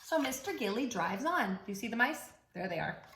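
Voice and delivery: normal talking voice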